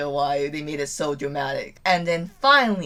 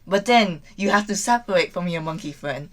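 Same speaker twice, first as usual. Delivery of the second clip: ordinary delivery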